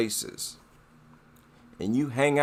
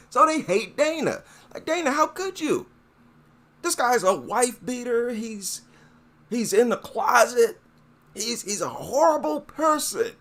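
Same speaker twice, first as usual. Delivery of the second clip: much higher, mock-whiny, hammed-up outrage; mocking whiny fan voice; 10 semitones higher than usual. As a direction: parody the fans as shrill complainers, pitched way up. Mocking the racist fans' outrage.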